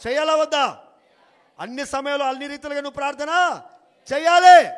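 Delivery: loud preaching shout. Acted. Pastor demanding prayer at all times, shouted preaching. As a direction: call it out to the whole church.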